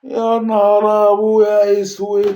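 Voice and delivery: slow, drawn-out cry of despair; dismayed cry. Real, not acted. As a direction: wail it like the pack just ruined you. Pack opening goes badly.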